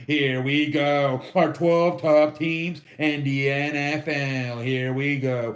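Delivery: slow, low announcer voice; drawn-out, deep show-opener delivery; announcer intro. Pretend. Host kicking off his top twelve teams, announcer intro.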